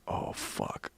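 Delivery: very soft, under the breath; near-voiceless retold cursing; hushed dread. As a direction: barely voice it, sinking realisation. Retold dread as host leaves.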